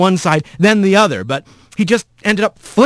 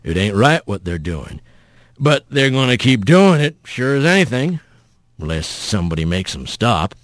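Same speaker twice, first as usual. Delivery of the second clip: folksy rough voice; 7 semitones lower than usual. Acted. Mysterious voice goads Rat to act, low and steady. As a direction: reason with him low and steady.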